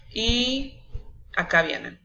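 ordinary delivery

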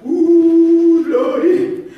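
long, high, loud wail of mock agony; hammed-up holler for help. Preacher imagines Jesus on the cross, mock hollering. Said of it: "and he hollering".

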